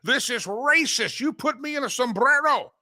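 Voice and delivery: mock outrage. Mocking Hakeem Jeffries' outrage over the sombrero video, high hammed-up indignation. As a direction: ham up the offended politician, voice jumping high. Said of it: "Hakeem Jeffries goes out and says".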